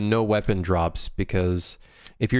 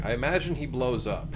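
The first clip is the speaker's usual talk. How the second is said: laughing; 6 semitones higher, 3 dB quieter than usual. Real, not acted. Laughing at chat joke.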